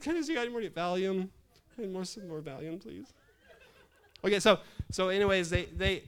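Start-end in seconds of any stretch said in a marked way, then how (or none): 0.0-3.1 high-pitched, imploring request; mock nervous plea. Patient begging staff for more Valium, mock nervous. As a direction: ask meekly in pretend worry, pitched up. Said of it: "kind of a little nervous here".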